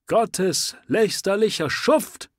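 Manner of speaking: high, loud cry of outrage; shouting. Acted. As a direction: yell it at the man with the sword. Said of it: "schrie der Anhänger".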